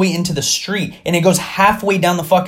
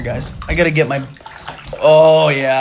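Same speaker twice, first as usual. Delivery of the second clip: ordinary talk, nothing marked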